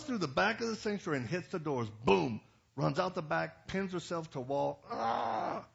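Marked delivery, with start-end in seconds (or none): 2.1-2.4 sudden loud boom; loud impact. Retold or imitated. Woman hitting the sanctuary doors, loud crash. Imitates doors being hit. 4.9-5.7 high mock demon shriek; demonic cry. Possessed woman pinned to the wall, shrieking. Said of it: "just like that".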